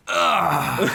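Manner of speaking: loud strained dad groan; effortful groan. Shown as a demonstration. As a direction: groan like getting up off the couch. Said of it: "I moan when I get up".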